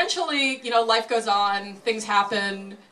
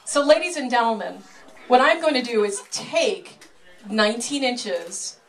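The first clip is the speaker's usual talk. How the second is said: showman patter; 3 semitones higher than usual. Acted. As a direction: announce it like a ringmaster. Sideshow pitch to audience.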